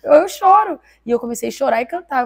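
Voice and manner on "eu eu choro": loud, high, breaking sob; sobbing loud and high, stammering eu; crying out. Retold or imitated. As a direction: cry out the words, voice breaking. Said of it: "Porque eu falei assim".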